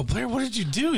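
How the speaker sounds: high-pitched mock dismay; retold reproach. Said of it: "I go".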